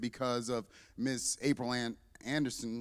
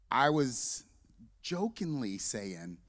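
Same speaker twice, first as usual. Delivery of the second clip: usual speaking voice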